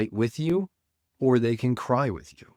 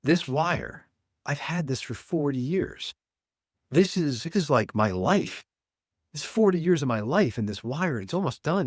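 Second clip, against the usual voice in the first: high, mildly tearful man's voice; a touch of a man choking up over his life; retold crying man; 5 semitones higher than usual. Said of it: "like, crying".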